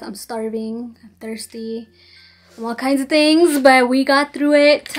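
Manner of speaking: normal talking voice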